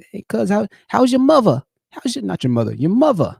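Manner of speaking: mock New York accent; New York cousin voice. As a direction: ham up a New York cousin asking after mom. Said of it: "That's how my cousins talk".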